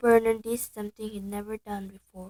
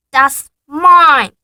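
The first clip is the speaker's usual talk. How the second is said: loud, higher protest; Harry shouting; 5 semitones higher, 21 dB louder than usual. Acted. Boy shouting at his uncle over his letter. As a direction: grab it back with a yell.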